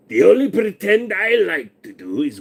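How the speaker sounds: put-on voice. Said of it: "when I make the voices".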